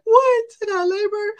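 high-pitched, loud incredulous outburst; shocked disbelief. Retold or imitated. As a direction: squeal it in mock shock. Retold shocked reaction to God's demand.

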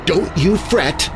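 slow, high menacing reassurance; understated slow hiss. A narrator's reading. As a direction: hiss it slowly, drawn out. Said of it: "she hissed".

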